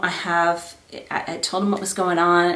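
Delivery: ordinary talk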